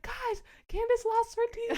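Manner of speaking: high, loud, drawn-out alarm; frantic shouting. Retold or imitated. Telling friends at the car about the accident, frantic shouting. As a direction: shout the news to everyone.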